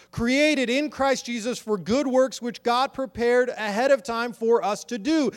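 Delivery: high, loud, slow preaching that stretches each phrase; urging the congregation to trust their own minds, impassioned preaching; preaching at full force. Acted. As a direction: lift the room with it, drawn out. Preaching the scripture list.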